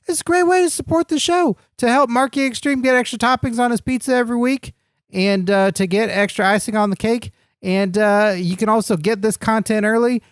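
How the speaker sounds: high, hyped-up sponsor pitch; excited promo read. Acted. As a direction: sell it like an announcer.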